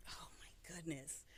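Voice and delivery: whispered reaction; barely voiced, to herself; very soft whispered exclamation; under her breath. Real, not acted. Reacting to a big question.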